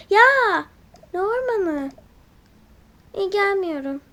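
loud high shocked cry; shocked outburst. Acted. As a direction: yelp at the idea. Refuses, shocked at forest.

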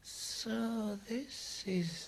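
high, slow snake voice; python voice. A narrator's reading. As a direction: draw it out with long sibilants. Python first addresses the boy.

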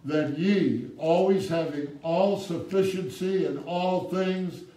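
ringing pulpit scripture; preaching at full force. Acted. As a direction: stretch each phrase like a promise. Loud slow scripture preaching.

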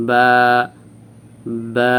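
"বা" opening wide from relaxed closed lips; long, open "বা" as a model; wide-open articulation. Shown as a demonstration. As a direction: release "বা" from both lips and hold the vowel long. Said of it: "বা বলে মুখ খুলবেন বড় করে".

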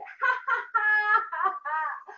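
a short high teasing laugh; playful mock laugh for the kids. Shown as a demonstration. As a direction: laugh like a sheep making fun of someone. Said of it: "¿Cómo se reía".

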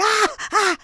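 panicked gasp. Pretend. Panic at room count.

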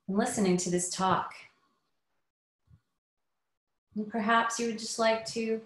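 unhurried meditation guidance with long pauses; guided meditation. Acted. Inviting listeners to settle in before the talk, guided meditation. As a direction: ease them inward, leaving space after each phrase.

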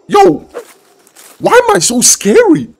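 sudden high yelp of shock; surprised shout. Real, not acted. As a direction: blurt it out startled. Shocked by own monster form.

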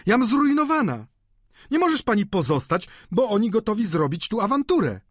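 high-pitched, distressed outcry; wailing, urgent plea; alarmed. A narrator's reading. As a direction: plead in a high, agitated voice. Landlady panics at losing lodgers.

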